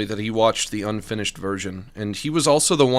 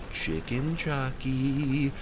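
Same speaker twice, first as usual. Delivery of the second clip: soft slow; 2 semitones higher, 6 dB quieter, much slower than usual. Acted. Says the name twice differently.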